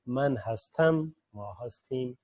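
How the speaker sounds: من ہستم drawn out slowly for repetition; slow model phrases. Shown as a demonstration. Teacher modelling verb forms for learners, slow. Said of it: "فعل وجودی".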